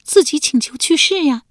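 voiced wife, eager. Wife answers her husband's quiz, eager. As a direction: pipe up as the wife who knows.

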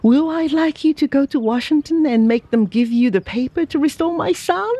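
a father suddenly full of hope; eager cry. Acted. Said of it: "cried he".

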